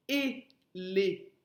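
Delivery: "éléphant" in three separate syllables with pauses. Shown as a demonstration. Teacher revealing the animal's name, "éléphant" split syllable by syllable. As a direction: break "éléphant" apart, one syllable at a time.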